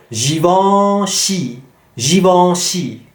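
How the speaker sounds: Givenchy drawn out slowly, nasal vowel in the middle; slow repeated Givenchy drill; slow French drill. Shown as a demonstration. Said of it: "same nasal vowel".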